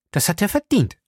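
high, prompt retort; quick and decided. A narrator's reading. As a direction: answer at once, pitched high. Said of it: "sagte sie schnell".

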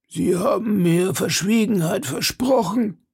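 put-on high, wavering old man's voice. A narrator's reading. Old man reminding D'Artagnan of his promise, high-voiced. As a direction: raise the pitch into an old man's plea. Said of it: "sagte der Greis".

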